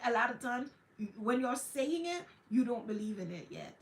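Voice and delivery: ordinary delivery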